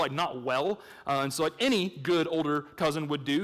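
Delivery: usual speaking voice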